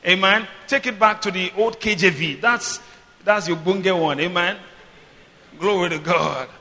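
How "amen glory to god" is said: ordinary talk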